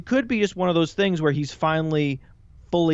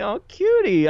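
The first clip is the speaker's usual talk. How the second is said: high, louder, adoring coo; smiling coo; 9 semitones higher, 5 dB louder than usual. Shown as a demonstration. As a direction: let a big smile into the voice. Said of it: "it makes me smile to say".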